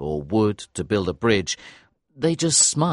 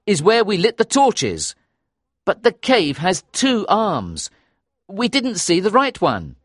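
higher-pitched, animated dialogue; excited explanation; 9 semitones higher, 3 dB louder than usual. A narrator's reading. Narrator telling companions about the cave's arms, excited. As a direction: explain the discovery eagerly.

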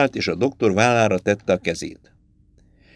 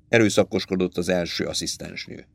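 plain reading voice

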